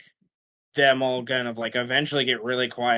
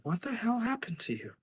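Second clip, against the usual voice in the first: worried wife; 11 semitones higher, 7 dB quieter than usual. Retold or imitated. Wife alone with husband asking.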